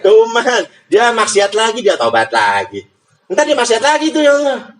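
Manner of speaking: high, exasperated tattling; tattling angel. Acted. Angel telling on the repeat sinner to God, high and exasperated.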